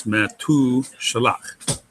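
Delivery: "metu shalach" slowly broken into syllables. Shown as a demonstration. Teacher showing syllables in a Hebrew word, slowly broken up. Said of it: "So it's open syllable".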